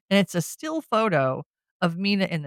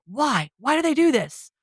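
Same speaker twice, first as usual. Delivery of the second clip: rising exasperation, pitched higher; exasperated; 8 semitones higher than usual. Real, not acted. Fan groaning at a horror movie mistake, exasperated. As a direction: throw up your hands at the cliché.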